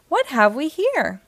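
plain reading voice

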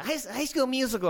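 high, loud mock kid voice; squeaky teenage scoff; incredulous teen. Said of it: "And they're like".

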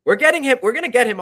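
high, loud burst of excitement; excited vow. Real, not acted. Host promises listeners a guest, loud and high.

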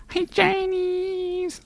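extremely high, slow and soft cooing; squeaky dog-talk call. Shown as a demonstration. Softly cooing to the dog Charlie. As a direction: croon it to the sleeping dog.

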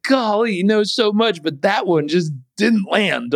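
high, sing-song mock admiration; high mock praise for dad's advice; mock sarcastic praise. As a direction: tease him with fake awe. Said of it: "I was like".

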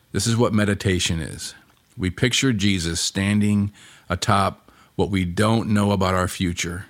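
usual speaking voice

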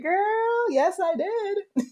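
put-on very high, loud, drawn-out "girl"; sassy, unapologetic confirmation, stretched out; emphatic admission. Retold proud admission to partner.

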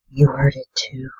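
hushed, slightly haunted question; haunted whisper. Acted. Marie looks up at Chet, whispering, slightly haunted. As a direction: whisper it, still a little bewildered. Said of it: "my gaze still slightly haunted".

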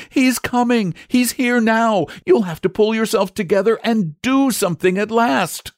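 put-on high, urgent boyish shout. A narrator's reading. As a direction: call out in alarm from afar. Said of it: "he shouted".

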